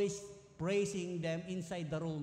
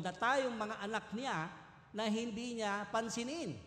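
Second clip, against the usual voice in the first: higher, louder preaching that slows at the end; fervent preaching; 3 semitones higher, 4 dB louder than usual. Acted. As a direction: drive the point home from the pulpit. Preacher builds to a fervent point.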